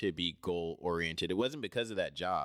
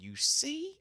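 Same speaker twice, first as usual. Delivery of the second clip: high-pitched, fed-up; high exasperated. Retold or imitated. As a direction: squeal your frustration. Venting to coworker about LA.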